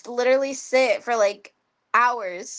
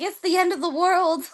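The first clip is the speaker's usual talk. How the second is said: higher-pitched mock doom, imitating a friend; hammed-up end-of-days lament; mock dramatic; 7 semitones higher than usual. Mimics a friend overreacting to app ban.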